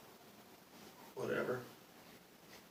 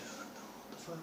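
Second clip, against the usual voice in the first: breathy whisper, pitched higher; whispered disbelief; 9 semitones higher, 15 dB quieter, slower than usual. Retold or imitated. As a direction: whisper it, stunned. Reacting to orbs all around.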